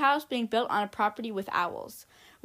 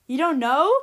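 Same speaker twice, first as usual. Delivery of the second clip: louder, rising sharply on "know" in disbelief; indignant interruption; 3 semitones higher, 9 dB louder than usual. Acted. Mother to the doctor, a loud incredulous rising question. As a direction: cut in, outraged, "know" climbing up. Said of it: "Missus Ebenhart interrupted".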